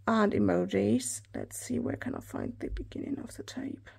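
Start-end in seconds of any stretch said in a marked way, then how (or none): 1.3-3.9 low whisper to herself while searching; whispered aside. Real, not acted. Vlogger whispering to herself while hunting for the tape end. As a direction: mutter it under your breath, unvoiced.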